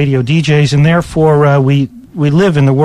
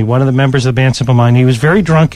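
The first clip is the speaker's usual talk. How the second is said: usual speaking voice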